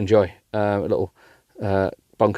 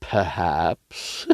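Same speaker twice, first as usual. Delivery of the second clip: low coy purr; 5 semitones lower, 6 dB quieter than usual. Acted. Voicing him coy.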